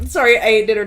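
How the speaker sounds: normal talking voice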